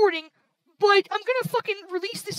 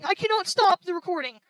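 ordinary delivery

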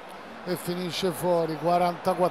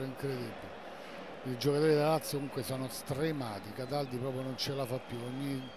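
much softer, lower worried murmur; muttered dread; 8 semitones lower, 9 dB quieter than usual. Real, not acted. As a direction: mutter it under your breath. Tense final minutes. (mormorio preoccupato)

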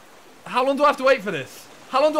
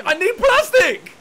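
shouting; 12 semitones higher, 8 dB louder, slower than usual. Real, not acted. Desperate demand to teammate.

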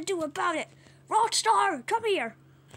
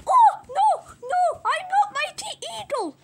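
very high, loud cartoon protest; panicked squawk; 12 semitones higher, 7 dB louder than usual. Bird denies being Mighty Eagle in a high panic.

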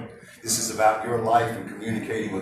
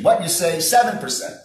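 "seven percent" punched high and louder; stressed seven; 6 semitones higher, 5 dB louder than usual. Shown as a demonstration. Speaker recapping the rule, emphatic. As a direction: hit "seven percent" hard. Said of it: "Three V's of communication".

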